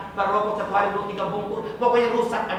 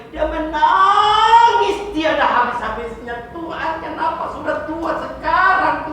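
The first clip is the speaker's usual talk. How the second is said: higher, louder, slow wailing prayer; old man crying out bertobat to God; tearful repentance; 9 semitones higher, 8 dB louder than usual. Retold or imitated. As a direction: wail out the repentance. Voicing old man's tearful repentance.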